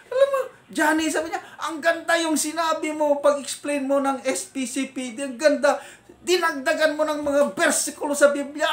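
high, loud mock praise, shouted through a laugh; angry commander scolding. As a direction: yell sarcastic compliments like a furious commander. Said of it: "Galit na galit".

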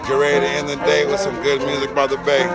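normal talking voice

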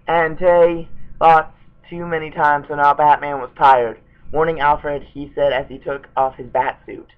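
reading voice for a silly story; fanfic reading. Acted. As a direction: read the bad fanfic out straight. Reads Batman fanfic aloud.

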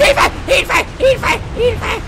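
high yelps; panicked calls for help. Real, not acted. Shot at by the monster, yelping for help. Under fire, calling for help.

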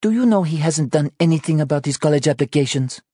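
fast, high-pitched nagging question; accusing. Acted. Anna confronts Joe about college applications, exasperated. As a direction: press the grievance quickly, pitched high.

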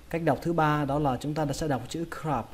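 normal talking voice

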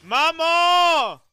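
high loud call across the house. Real, not acted. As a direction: call to someone in another room. Calling out to mother.

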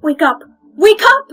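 urgent rising yells; shouting wake up. Acted. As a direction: yell to wake her. Trying to wake a friend.

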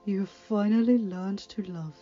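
put-on slow, tender old woman; loving elderly mentor; old teacher. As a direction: linger fondly on each word. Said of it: "her old teacher said".

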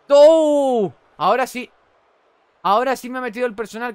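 loud, high, quick cries of triumph; excited shouting. Real, not acted. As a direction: yell in triumph. Por fin acierta el golpe. (gritos de triunfo al acertar)

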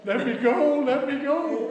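high-pitched imitation of a pleading bully; mock begging. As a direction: act out a kid begging to be released. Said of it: "He started begging".

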